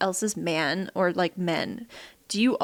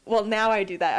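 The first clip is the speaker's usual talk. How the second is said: usual speaking voice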